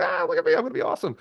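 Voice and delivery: very high, squeaky mocking voice; Elon Musk parody. Mocking a lone rocket builder to the audience, squeaky parody voice. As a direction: squeal it like a silly show-off. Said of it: "If Elon Musk wanted to build a rocket by himself, like".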